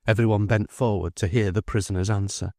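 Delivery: usual speaking voice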